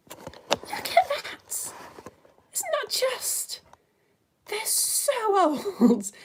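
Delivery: high, hushed squeal of wonder; awestruck. Real, not acted. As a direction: draw it out slowly, marvelling in a squeaky whisper. Marvelling at fossil eye.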